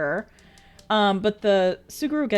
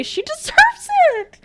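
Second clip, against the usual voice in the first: laughing; 6 dB louder than usual. Real, not acted. Reading chat laughing.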